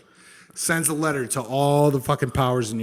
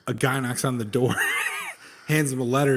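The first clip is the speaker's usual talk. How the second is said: ordinary talk, nothing marked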